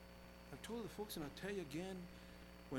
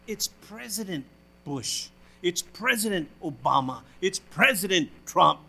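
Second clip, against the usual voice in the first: "President" leaned on slowly and firmly; stress on President; 3 semitones lower, 14 dB louder, slower than usual. Shown as a demonstration. Preacher correcting the congregation, pressing "President" slowly and firmly. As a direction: correct them, landing hard on "President". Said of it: "I always hear Obama, Trump, Bush".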